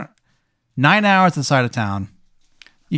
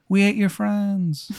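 high, slow, softer gloating call; gloating wolves; 7 semitones higher, 5 dB quieter, slower than usual. Acted. Wolves howling at the lost walker, gloating. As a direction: draw out a soft, high wolfish taunt. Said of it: "the gloating wolves".

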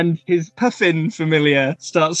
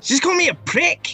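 put-on prickly Scottish kid; 12 semitones higher than usual.

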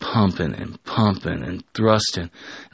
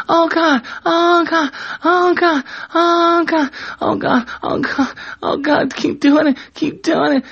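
very high, loud repeated cries; acted high-pitched screaming; 5 dB louder than usual. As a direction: squeal far above your normal voice. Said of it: "scream as loud as she can".

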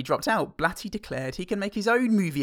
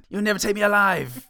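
defiant cry; 8 semitones higher, 7 dB louder than usual. Acted. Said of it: "guards come in".

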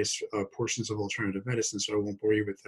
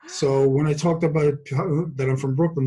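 Brooklyn accent; 4 semitones higher, 6 dB louder than usual. Shown as a demonstration. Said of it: "this is actually what I sound like".

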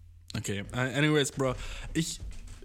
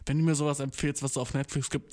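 ordinary delivery

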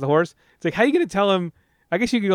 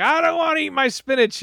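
mock childish whine, higher; whiny refusal; 12 semitones higher than usual. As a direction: whine like a kid refusing food. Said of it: "you can see he's like".

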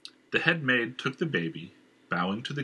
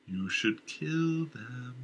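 slow, eerie voice in his head pressing him; sinister whisper-like inner voice; agitated inner voice; 4 semitones higher, much slower than usual. Acted. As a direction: urge the killing, drawn out and uncanny. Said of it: "the voice said with agitation".

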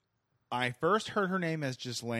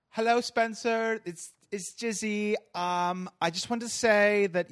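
high, loud English put-on voice; drawn-out, hammed-up English socialite; bad English accent; 9 semitones higher, 7 dB louder than usual. Jizzy sending Spencer a video message, badly faked English accent. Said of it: "I can't do a good English accent".

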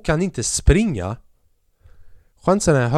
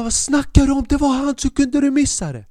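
much higher, incredulous yell; outraged protest; 13 semitones higher than usual. Retold or imitated. Player arguing with the referee, high outraged protest. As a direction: protest to the ref in disbelief. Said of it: "Jag bara".

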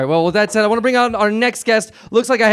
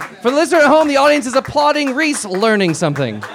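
talk show host aside; 6 semitones higher than usual. Acted. Host aside to radio listeners.

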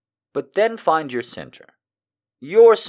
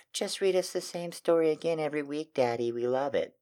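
mock women fans; 3 semitones higher, 5 dB quieter than usual. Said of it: "a bunch of women going".

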